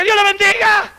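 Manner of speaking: high, loud stadium blessing; preacher's booming blessing to the stadium; preaching shout. Retold or imitated. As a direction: bless the crowd from the stage. Preacher before 55,000 people.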